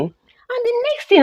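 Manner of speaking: usual speaking voice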